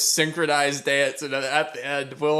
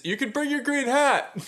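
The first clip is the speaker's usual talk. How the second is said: lightly voiced drunken suggestion, higher; a hint of a tipsy invitation; imitating drunk rambling; 11 semitones higher than usual. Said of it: "Will Ferrell drunkenly talking about streaking".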